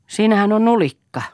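high, loud spiteful jeer; nasty. A narrator's reading. Father mocking the boy in the doorway, nasty. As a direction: jeer it loudly, with malice. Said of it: "sanoi Eero ilkeästi".

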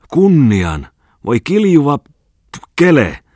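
high, loud angry outburst; spitting outrage. A narrator's reading. Maljanen answers Ripatti's threat of slander, spitting with fury. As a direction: explode into a cursing yell. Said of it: "Maljanen vastasi sylkien".

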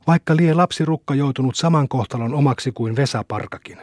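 ordinary delivery, nothing marked

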